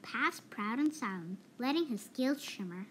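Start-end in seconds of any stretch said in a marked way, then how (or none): none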